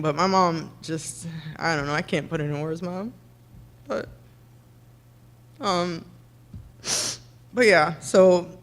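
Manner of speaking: steady voice with shaky moments; fighting back tears, voice wavering; tearful, choked up. Real, not acted. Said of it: "I'm emotional".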